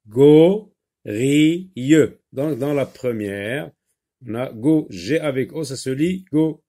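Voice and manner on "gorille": "Gorille" slow and loud as go, ri, ye; teacher's drawn-out syllable count on "Gorille"; split into syllables. Shown as a demonstration. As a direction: pause between each syllable of "Gorille", go... ri... ye. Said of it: "si on sectionne, euh, le mot en syllabes".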